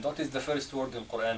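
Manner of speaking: normal talking voice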